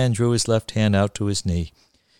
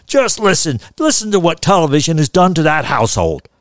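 loud, raised, indignant grandpa; high-pitched disapproving outburst; vindicated ranting; 7 semitones higher, 8 dB louder than usual. Acted. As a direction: proclaim it as proof to everyone. Grandpa loudly blaming television next door.

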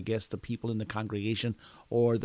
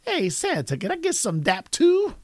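put-on high-pitched kid asking eagerly; put-on kid voice, rising high; imitated second kid; 13 semitones higher than usual. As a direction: pitch it well up like a child. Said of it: "the second kid comes up to me".

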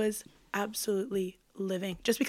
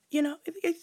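ordinary talk

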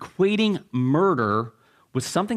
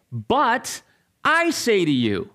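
raised pitch, emphatic pause after BUT, falling close; Jesus declaring; 6 semitones higher, slower than usual. Acted. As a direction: pronounce it with authority, stressing I. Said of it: "then he's going to say".

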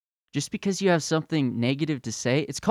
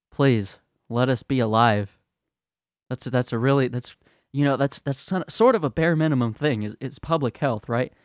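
usual speaking voice

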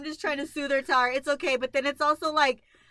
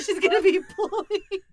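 laughing while repeating it; laughing echo of her friend's line; 5 semitones higher than usual. Real, not acted. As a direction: giggle the words out.

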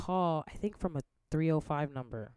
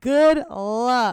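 higher, louder mock farewell; mocking quote; 7 semitones higher, 10 dB louder than usual. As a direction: toss it off like a sarcastic goodbye. Quoting roommate's parting words.

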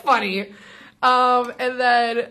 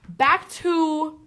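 quoting [name]; 5 semitones higher than usual. Retold or imitated. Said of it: "And she goes".

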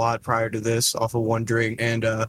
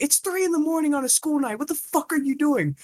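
much higher, scolding grandma voice; angry grandma; 14 semitones higher than usual. Retold or imitated. Grandma yelling at grandson at three in the morning, much higher. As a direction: scold him like a furious grandma. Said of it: "She's like".

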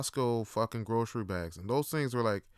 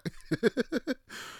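high chuckling giggle; light laugh in quick pulses; chuckle, looking back on it; 11 semitones higher than usual. Real, not acted.

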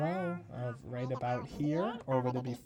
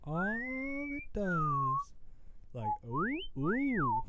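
much higher, slow whistling filter; whistle voice effect; much slower than usual. Shown as a demonstration. Showing the friend the whistle voice effect. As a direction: let the filter whistle each sound. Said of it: "It's a whistle".

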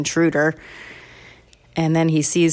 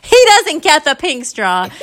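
put-on higher, louder childish claim; indignant sister; 9 semitones higher, 6 dB louder than usual. Sister grabs the straw from her brother, indignant protest. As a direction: insist on it like a possessive kid.

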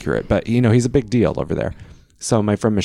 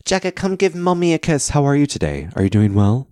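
much higher, sing-song mock posh accent; a mother cooing in a fake posh accent; Dorit's mixed accent; 7 semitones higher than usual. Dorit calling her son Jagger, high mock posh accent. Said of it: "She's like".

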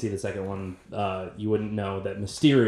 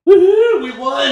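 cheering; 12 dB louder than usual. Pretend. Cheering at friend's praise.

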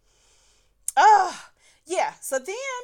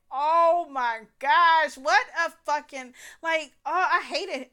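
disgusted exclamation; 5 semitones higher, 8 dB louder, slower than usual. Retold or imitated. Disgusted at his rhinestone teeth.

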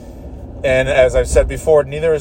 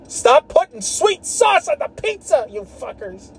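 much higher, drawn-out exasperated yell; scolding New Englanders over sweet sauce, a slow high yell; mock ranting yell. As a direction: bellow it at all New England.

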